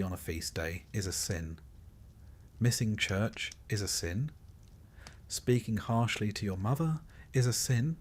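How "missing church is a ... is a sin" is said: usual speaking voice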